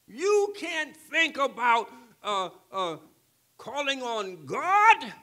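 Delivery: loud, high, drawn-out preacher's shout; preaching at full force. Acted. Pastor to the church about the thirsty land, full preaching shout. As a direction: whoop the dry land home to them.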